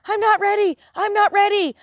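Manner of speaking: high, loud panicky protest; exaggerated high-pitched resistance; mock panic. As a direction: play someone frantically refusing, hammed-up. Acting out reluctant ones resisting ascent.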